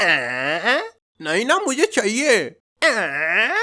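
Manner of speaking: high, whiny mock child's wail; whiny crying kid. Comic imitating a bawling kid who wants something, whiny wail. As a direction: whine like a spoiled toddler. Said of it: "बच्चे साले कैसे रोते हैं".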